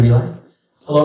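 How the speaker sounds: usual speaking voice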